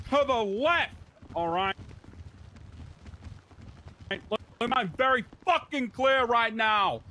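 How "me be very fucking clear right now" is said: loud gung-ho jock. Acted. Jock cop yelling at recruits.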